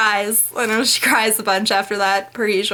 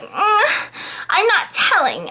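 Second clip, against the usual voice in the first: high, coy mock drunk tease; playful higher whine teasing him; coy drunk Anna; 9 semitones higher, much slower than usual. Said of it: "And she's like".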